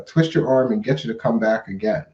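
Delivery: usual speaking voice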